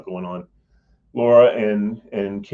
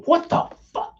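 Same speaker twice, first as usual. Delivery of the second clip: mock scream; 3 dB louder than usual. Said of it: "He wanted to scream".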